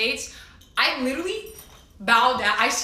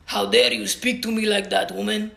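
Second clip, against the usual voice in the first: put-on voice of an affronted official; hammed-up official indignation; mock offended guard; 2 semitones lower than usual. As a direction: act out a guard scolding someone. Guards scolding mom, acted out.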